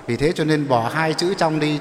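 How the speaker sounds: ordinary delivery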